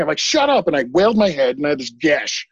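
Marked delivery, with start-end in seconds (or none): 0.2-0.7 retold angry snap, pitched up; mock exasperated outburst. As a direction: snap it as if fed up, without yelling. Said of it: "I got so angry".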